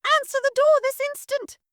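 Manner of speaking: very high, shrill-pitched commanding matron; imperious mistress. Acted. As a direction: order the maid about from on high. Mistress orders maid.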